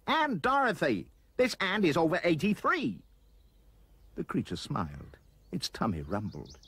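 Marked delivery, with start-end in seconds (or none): 0.1-3.0 put-on high, excited boy's exclamation; crying out. A narrator's reading. Roy introduces aunt Dorothy to the anteater, crying out. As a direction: call it out, pitched way up. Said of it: "cried Roy".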